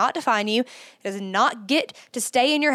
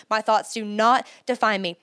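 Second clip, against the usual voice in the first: ordinary talk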